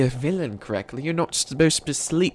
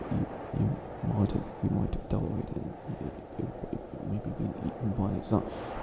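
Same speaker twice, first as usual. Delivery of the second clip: hushed musing; 4 semitones lower, 7 dB quieter than usual. Acted. Villain muses over sleeping captive.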